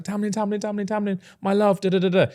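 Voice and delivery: high sugary calling of his name; fake sweet call. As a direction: lovestruck mock falsetto. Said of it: "she's like".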